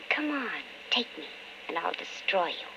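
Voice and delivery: soft, low voice of the temptation; whispering temptation. Acted. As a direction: hush it like a voice in her head. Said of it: "always in the back of your brain whispering".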